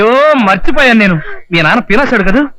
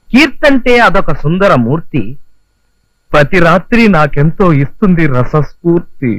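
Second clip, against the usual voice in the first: reciting a poem; 4 semitones lower, slower than usual. Acted. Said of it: "ఇంకేం రాశాను చదువుతాను చూడు".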